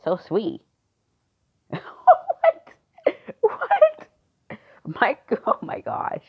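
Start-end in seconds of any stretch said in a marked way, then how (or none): 1.8-4.1 very high, loud shocked squeal. Acted. Friend hearing the new boyfriend news, shocked squeal. 5.0-6.2 giggly disbelief. Acted.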